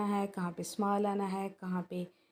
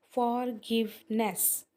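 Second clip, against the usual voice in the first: Forgiveness slowly split into three syllables; much slower than usual. Shown as a demonstration. Teacher counting syllables, forgiveness slow and divided. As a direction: stretch out forgiveness, three separate beats. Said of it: "तीन इसके syllables बन रहे हैं".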